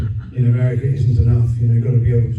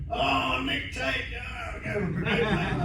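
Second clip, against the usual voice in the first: high, hushed mock-starstruck greeting; imitated fan delight at an introduction; gushing recognition; 11 semitones higher, 11 dB quieter, much slower than usual. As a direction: gush in a soft, high voice. Acting out actors meeting him, starstruck.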